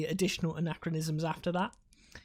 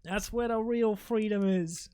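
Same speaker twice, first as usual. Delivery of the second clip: stretched-out mock Boston accent; hammed-up Boston vowels; 2 semitones higher than usual. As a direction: parody a proud Bostonian. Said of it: "sorry to everybody from Boston".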